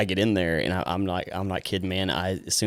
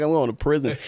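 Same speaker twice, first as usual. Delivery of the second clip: panicked; 8 semitones higher, 5 dB louder than usual. Retold or imitated. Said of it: "And I started crying".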